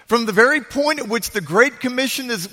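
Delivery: ordinary talk, nothing marked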